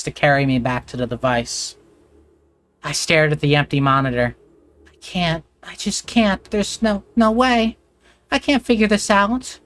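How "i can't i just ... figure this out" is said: high, rising panic, voice climbing; a frightened girl losing her nerve; panicked girl. Acted. Girl alone at the dead monitor, panicking.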